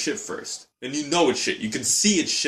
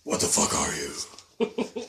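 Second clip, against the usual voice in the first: low, whispery creature-film quote; acted film line in a low voice; put-on movie accent; 5 semitones lower, 4 dB quieter than usual. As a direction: drop it low and rasped, with a pause before the last word. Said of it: "gotta say it with an accent".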